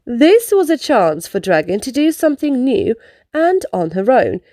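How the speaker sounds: plain reading voice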